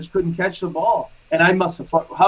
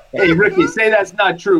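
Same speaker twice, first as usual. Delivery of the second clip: ordinary talk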